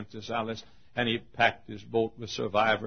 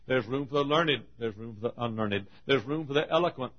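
ordinary delivery

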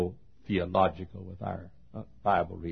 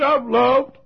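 grieving son; 14 semitones higher, 9 dB louder, slower than usual. Retold or imitated. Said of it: "He said".

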